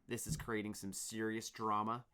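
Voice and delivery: plain reading voice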